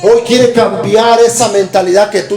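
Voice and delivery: slow, loud, climbing preaching; fervent preaching. Acted. Preacher exhorts the congregation, slow, loud and climbing. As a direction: drive it home from the pulpit.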